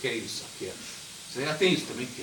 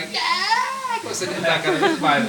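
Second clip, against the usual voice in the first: mock tantrum; 4 dB louder, slower than usual. Child tantrum in supermarket.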